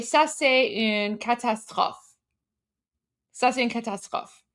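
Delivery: measured French-accented demonstration. Shown as a demonstration. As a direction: say it as French, with a throaty r. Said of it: "say it with a French accent".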